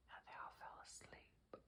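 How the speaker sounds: soft bedtime whisper aside. Real, not acted. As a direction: whisper so the bear won't wake.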